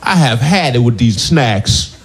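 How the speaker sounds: slow, fed-up movie tough guy; slow angry drawl of a fed-up star; Samuel L. Jackson. Pretend. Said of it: "my impression of Samuel L. Jackson".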